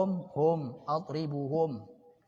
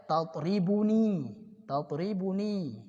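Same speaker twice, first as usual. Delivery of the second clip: "Tatribuni" said slowly and carefully; slow teaching model; slower than usual. Shown as a demonstration. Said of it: "kamu sedang mukul saya". (ucapkan "Tatribuni" perlahan)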